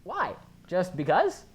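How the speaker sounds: high exasperated why; incredulous. Real, not acted. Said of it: "incredulous complaint".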